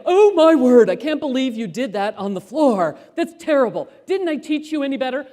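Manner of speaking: scolding. Pretend. Imagined scolding of son.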